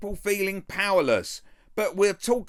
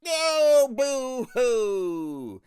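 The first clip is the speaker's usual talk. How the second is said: mock crying; 4 semitones higher, much slower than usual. Commentator mocking mayor's complaints.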